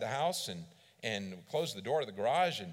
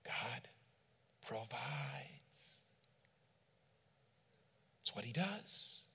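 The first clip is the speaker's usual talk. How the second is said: soft; 7 dB quieter, much slower than usual. Real, not acted. Reflective turn in sermon.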